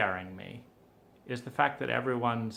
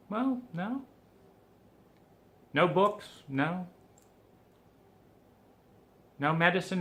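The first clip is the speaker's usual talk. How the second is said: exasperated questioning; 7 semitones higher, 4 dB louder than usual. Retold or imitated.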